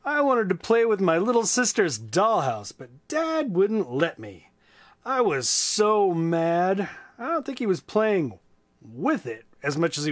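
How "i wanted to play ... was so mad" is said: lively, high storybook voice; reading to children. A narrator's reading. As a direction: read it big and bright for kids. Reads a children's book aloud.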